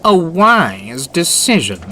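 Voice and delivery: high, slow, smug and triumphant. A narrator's reading. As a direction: savour the win, smirking. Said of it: "Spam Can smirked triumphantly".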